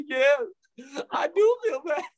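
high, whimpering put-on misery; mock self-pity. Answering Satan at the pity party, mock tearful whine.

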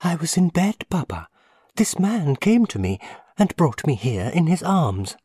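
put-on high, light child voice, simply told; a little boy answering plainly; simple small boy. A narrator's reading. Small boy tells his father simply. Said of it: "answered the boy simply".

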